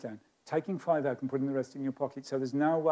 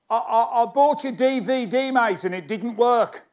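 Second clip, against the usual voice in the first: higher, louder caller with a grievance; phone in a complaint, pitched up; complaining customer; 6 semitones higher, 5 dB louder than usual. Acted. Disgruntled buyer phones the performer about a DVD, complaining. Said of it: "Someone will phone and say".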